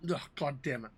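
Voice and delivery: hiccuping between words; talking through hiccups. Real, not acted. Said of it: "I think I got the hiccups".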